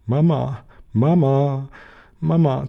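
lightly voiced tiny boy, pitch climbing; chirping child. A narrator's reading. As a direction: chirp it like a little bird-sized child. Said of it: "zirpte Philipp".

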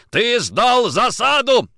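high, loud, drawn-out accusation; furious bellow. A narrator's reading. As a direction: roar it while striking. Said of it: "орал Никитин, продолжая бить".

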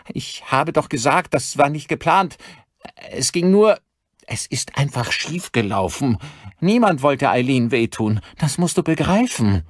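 high, agitated excuse-making; desperate pleading. Acted. Son begs his armed father to understand, desperate high pleading.

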